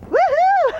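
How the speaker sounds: very high whoop breaking into a laugh. Real, not acted. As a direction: cheer the horse on and laugh. Cheering, then laughing.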